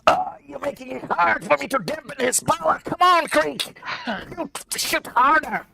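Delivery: very high-pitched, strained cartoon voice; Morty. Companion urging Creek to shoot harder, high squeaky character voice. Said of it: "And Morty says".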